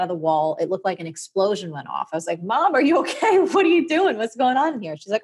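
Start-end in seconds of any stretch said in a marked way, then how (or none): 2.4-5.0 put-on much higher and louder, startled kid; alarmed calling out. Kid finding mom amid power tools, alarmed high call. Kid finds wrecked bathroom, calls out.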